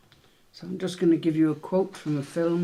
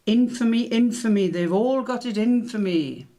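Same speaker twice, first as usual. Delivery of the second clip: higher and louder, theatrical cry of infamy; hammed-up comic quote; 6 semitones higher, 7 dB louder than usual. Quizmaster hamming up a film quote for players, loud and high. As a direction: ham up the comic Roman's complaint. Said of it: "I will try the odd accent".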